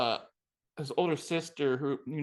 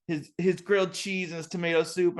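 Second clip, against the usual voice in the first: normal talking voice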